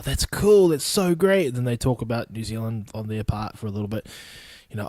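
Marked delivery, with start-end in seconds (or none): 0.0-1.5 higher, gushing imitation of an impressed listener; mock impressed. American hearing he's from New Zealand, mock impressed. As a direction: play an American pleasantly amazed.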